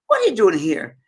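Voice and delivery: loud, high Scottish question; Scottish accent. Retold or imitated. Scottish lady questions little girl in next bed, Scottish accent. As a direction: ask it as a startled Scottish lady. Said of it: "I'm trying to my Scottish accent".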